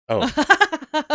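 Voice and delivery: high burst of laughter; laughing. Real, not acted. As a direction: laugh out a surprised oh. Laughing at effect.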